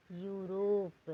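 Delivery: Europe stretched out very slowly, each sound held; slow model word. Shown as a demonstration. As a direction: say Europe very slowly, spelling it with your voice. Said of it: "जैसे कि". (Europe को बहुत धीरे, खींचकर बोलना)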